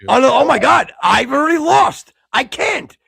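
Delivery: loud, high wail of dismay; high-pitched yell of despair; exasperated shouting. Real, not acted. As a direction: cry out as if you just blew it. Despairs at own slip.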